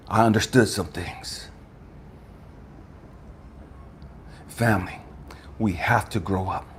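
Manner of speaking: very low, soft and measured; hushed solemn preaching. Real, not acted. Speaker shares his awakening with family, hushed and solemn. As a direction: bring it right down, with long pauses.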